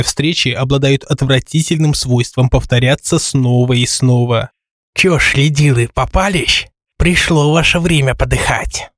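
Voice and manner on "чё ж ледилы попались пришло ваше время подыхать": gloating lisp, pitch rising; triumphant nasty lisping voice. Acted. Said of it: "Торжество Чмо".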